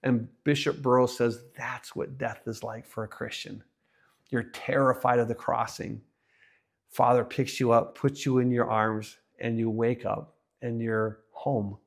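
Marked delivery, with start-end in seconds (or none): 1.6-11.8 soft, low storyteller's hush; tender reassurance. Retold or imitated. Bishop explaining death to a woman through a story, tender reassurance. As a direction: let it settle gently, low and quiet.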